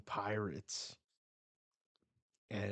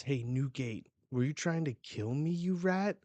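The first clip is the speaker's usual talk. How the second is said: higher and louder with every word, ending in a yell on rat; angry accusation; 5 semitones higher, 5 dB louder than usual. Acted. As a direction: accuse him, building to a furious shout. Angry villain quote read aloud.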